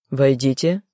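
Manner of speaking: low and calm, a man's voice; low calm male voice. A narrator's reading. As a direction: call them in, low and unhurried. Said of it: "низкий и спокойный".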